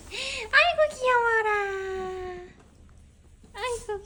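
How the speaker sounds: high cooing; talking to a dog. Real, not acted. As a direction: gush over the cute puppy. (강아지에게 귀여워하는 말투)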